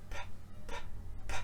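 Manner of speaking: "p" as a bare puff of air; voiceless P. Shown as a demonstration. Teacher showing voiceless sounds, "p" as pure air. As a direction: pop "p" without any voice. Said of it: "that's voiceless".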